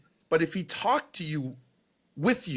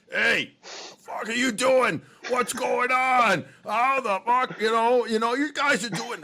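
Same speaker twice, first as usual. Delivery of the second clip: high, shouted, slowed-down rough coach; Red's gruff voice; 9 semitones higher, 3 dB louder, slower than usual. Retold or imitated. Buddy coach calling a broadcaster, cursing in a shout. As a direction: bark it out like a salty old coach on the phone. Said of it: "and Red, typical Red".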